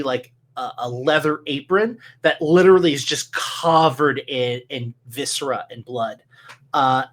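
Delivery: normal talking voice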